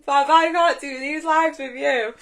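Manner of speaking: laughing while talking. Real, not acted. Teasing friend Fab on the live, laughing through words. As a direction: still giggling as she scolds her friend.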